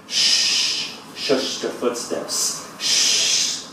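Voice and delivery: long, whispery shushes; hushed shushing. Acted. Poet shushes the footsteps in the poem, whispered and slow. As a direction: hush the footsteps in a drawn-out whisper.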